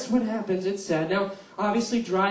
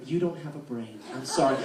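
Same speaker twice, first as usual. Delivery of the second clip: ordinary talk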